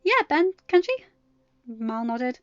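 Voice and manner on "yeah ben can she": eager chime-in. A narrator's reading. Second girl chimes in eagerly.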